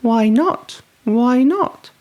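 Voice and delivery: high, eager little-monster voice; high curious child voice; child's question. A narrator's reading. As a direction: ask like a curious small child. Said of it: "Gruffalo's child asks".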